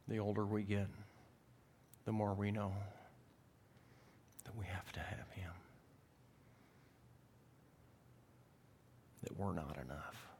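soft, sinking almost to a whisper; subdued pulpit hush; hushed preaching. Real, not acted. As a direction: confess it quietly, pausing between phrases.

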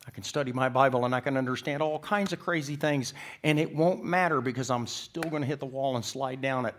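fervent preacher in full flow; impassioned preaching. Real, not acted. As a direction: drive it home at full force.

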